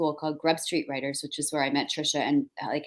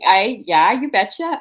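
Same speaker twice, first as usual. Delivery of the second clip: lively, higher-pitched rural Minnesota accent; 4 semitones higher, 9 dB louder, slower than usual. Shown as a demonstration. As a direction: lay on a playful Minnesota accent. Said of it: "you do the accent".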